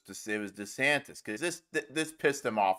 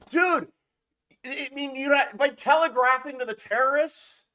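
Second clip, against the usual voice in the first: high, disbelieving outburst; can't believe what he just read; incredulous; 9 semitones higher than usual. Real, not acted. Vlogger reacting to a post, incredulous and pitched high.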